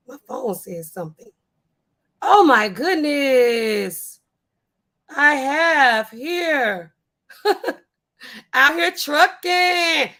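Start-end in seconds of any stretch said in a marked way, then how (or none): 2.2-10.1 very slow, drawn-out, louder and rising higher; hammed-up donation announcement. Streamer announcing a viewer's cash donation, drawn-out showy announcer delivery. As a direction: stretch every word like a grand prize reveal.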